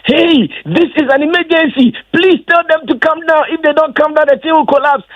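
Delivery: high-pitched mock shout of an emergency order; imitating urgent shouting. Roland on the walkie-talkie about people climbing trusses, imitated high-pitched shouting. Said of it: "he was shouting on top of his voice".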